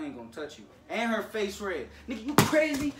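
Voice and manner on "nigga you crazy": high, loud disbelief; shocked loud outburst at the sibling. Real, not acted. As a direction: blurt it out in shock. Sister's face is red.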